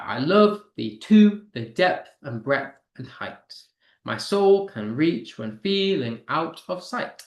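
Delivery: slow verse, hitting "LOVE", "TO", "DEPTH", "BREADTH", "HEIGHT"; stressed syllables. Shown as a demonstration. Teacher reading a sonnet so students hear the stressed syllables. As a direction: punch every second syllable, as in "SOUL", "REACH", "FEEL". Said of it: "all I'm doing is stressing the syllables".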